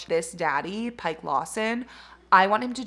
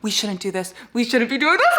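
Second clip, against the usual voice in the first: forbidden lovers' line; 2 semitones higher, 5 dB louder than usual. Acted. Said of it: "when it's like".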